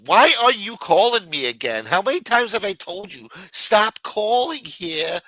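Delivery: angry ex-friend. Acted. Acted quarrel on pretend call.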